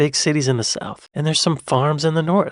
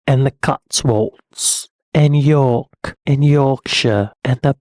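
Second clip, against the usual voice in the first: very slow, exaggerated English accent; mock English accent; 4 dB louder, much slower than usual. American listing English places to visit, mock English accent. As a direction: stretch each place name in a hammed-up English accent.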